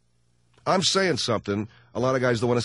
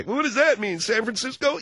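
wife's voice, high; 13 semitones higher than usual. Retold or imitated. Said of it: "wife voice".

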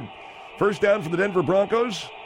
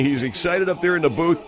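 usual speaking voice

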